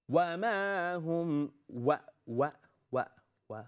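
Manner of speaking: slow chant, then "و" repeated in short separate bursts; lip-rounded w demo. Shown as a demonstration. Teacher drilling the letter for students, "و" repeated with clear lips. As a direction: chant "وما هم", then isolate each rounded "و". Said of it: "use your lips".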